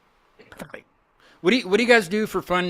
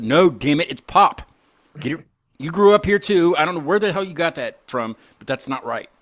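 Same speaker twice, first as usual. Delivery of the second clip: louder, emphatic mock argument; retold arguing back; 3 semitones higher, 5 dB louder than usual. Retelling argument with best friend over pop, emphatic objection. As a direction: argue back insistently, then ease off. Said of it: "I said".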